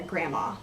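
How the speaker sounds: "grandma" said as GRAM-ma; without the D. Shown as a demonstration. Vlogger naming dad's mother, "grandma" without the D. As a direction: drop the D in "grandma". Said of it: "without the D".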